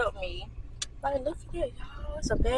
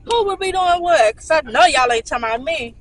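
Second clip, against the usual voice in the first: louder and higher, playfully calling out her friends; mock confrontation; 5 semitones higher, 7 dB louder than usual. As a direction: act like you caught them gossiping. Mock confronting friends on phone call.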